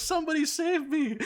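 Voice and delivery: very high-pitched pleading wail; mock cry for help. Imitating a dad begging for rescue, mock panic. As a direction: squeal it like a mock victim.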